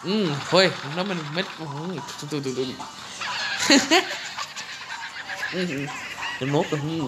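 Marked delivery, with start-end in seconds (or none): 2.8-5.5 laughs. Real, not acted. Laughing at the game.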